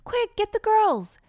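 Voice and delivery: put-on excited, urgent boy calling out; urgent yelling back. A narrator's reading. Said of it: "Micah yelled back".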